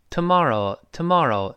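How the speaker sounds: American "tomorrow" with an open ah, tuh-MAR-oh; American pronunciation. Shown as a demonstration. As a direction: say "tomorrow" the easy American way, tuh-MAH-roh. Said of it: "美国人呢".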